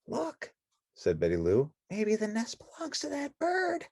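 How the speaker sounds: put-on high, soft girlish voice; Betty Lou voice. Betty Lou pointing to the bird, soft and high.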